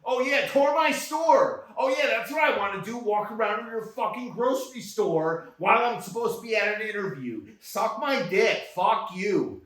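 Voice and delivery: high, scornful sneer that turns into cursing; mock their request with biting sarcasm; sarcastic mockery. Mocking the store's request.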